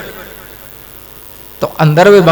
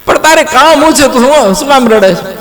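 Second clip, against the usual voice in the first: pleading beggar; 6 semitones higher than usual. Acted. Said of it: "તો પેલો કહે છે".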